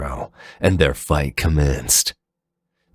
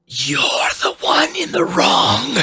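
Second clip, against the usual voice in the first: much higher shouted roar. Acted. Fafnir roars back at Zero, shouting. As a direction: roar the accusation. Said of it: "Fafnir roared".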